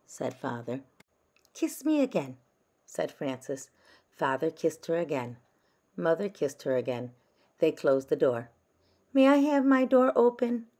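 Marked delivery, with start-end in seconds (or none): 1.5-2.4 put-on high, pleading child; a small girl asking for one more kiss; little girl voice. A narrator's reading. Francis begs her parents for another kiss, high child voice. 9.1-10.7 put-on high, louder child voice; little girl voice. A narrator's reading. Francis asks her parents to leave the door open, high child voice.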